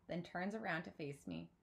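plain reading voice

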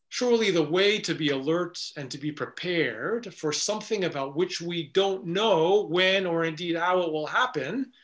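ordinary talk, nothing marked

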